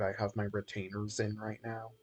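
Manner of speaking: ordinary talk, nothing marked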